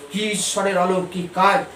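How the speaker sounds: normal talking voice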